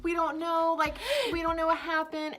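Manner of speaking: high, loud, worried pleading; mock distress. Friends report the crying phone call, mock distress. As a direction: act out a frantic report to an adult.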